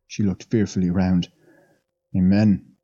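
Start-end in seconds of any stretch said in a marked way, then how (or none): none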